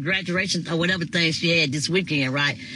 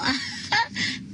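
high, soft giggle; 5 dB quieter than usual. Real, not acted. Laughing while apologising to viewers.

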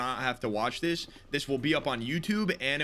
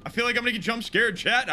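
fast, louder nervous rush; scared horror game streamer; 7 semitones higher, 5 dB louder, faster than usual. Real, not acted. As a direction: panicky words tumbling out fast. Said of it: "I don't feel safe right now".

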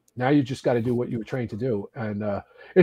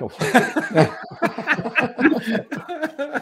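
burst of laughter; 11 semitones higher than usual. Real, not acted. Laughing at his own story.

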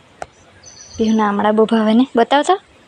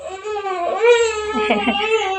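laugh; 8 semitones higher, 5 dB quieter than usual. Real, not acted. Laughing at sulking child.

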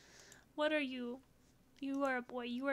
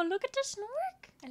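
squealing delight; 11 semitones higher, 4 dB louder, slower than usual. Real, not acted. Gushing over a puppy.

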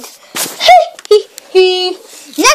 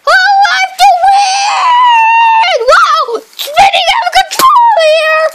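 desperate excited cries; 10 semitones higher than usual. Real, not acted. Kid in a racing game crying out to win. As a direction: cry out half laughing, half pleading.

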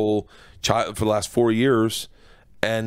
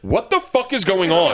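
high-pitched, incredulous retold outburst; mock bewilderment; 8 semitones higher than usual. Comic replaying his reaction to the wailing men, mock disbelief. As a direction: act baffled by it, pitched up.

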